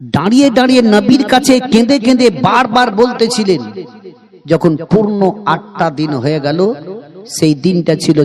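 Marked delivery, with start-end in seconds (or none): 0.0-3.8 high, fast rising sermon cry; fervent preaching. Acted. Preacher telling of eight days weeping at the tomb, high fervent preaching. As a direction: push it high in an urgent preacher's swell.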